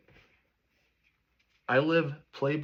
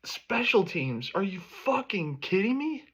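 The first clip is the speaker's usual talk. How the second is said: exasperated outburst; 6 semitones higher than usual. Real, not acted. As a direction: pitch jumps higher in disbelief. Fan ranting at his team's loss.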